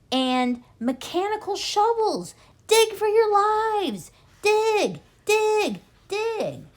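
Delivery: high, loud and slow panicked command; frantic shout. A narrator's reading. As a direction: shout at the family to dig for their lives. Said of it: "shouted Mister Fox".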